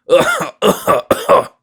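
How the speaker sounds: harsh, drawn-out coughing; racking cough. A narrator's reading. Sick miser coughing while the stranger talks. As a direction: cough like it tears the chest.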